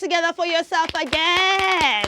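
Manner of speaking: loud, high welcome-back cheer; energetic kids-host greeting; excited welcome. Acted. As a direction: pump up the young viewers. Welcoming kids back after break.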